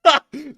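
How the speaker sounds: loud high real laugh; burst of laughter. Real, not acted. Laughing at the Russian scream.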